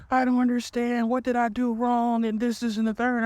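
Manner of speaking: high-pitched imitation of a bawling girlfriend; mock crying. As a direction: whine it up high, as if bawling, exaggerated. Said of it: "she crying, bawling".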